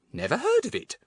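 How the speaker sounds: high-pitched, curt brush-off; suspicious dismissal. A narrator's reading. Thin woman answering a bundled-up stranger, suspicious. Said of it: "looking most suspiciously".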